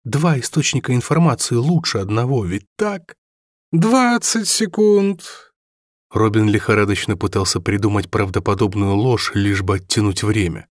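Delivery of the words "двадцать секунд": very high, very slow taunt; drawn-out countdown. A narrator's reading. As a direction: stretch each word out cruelly. Villain counts down.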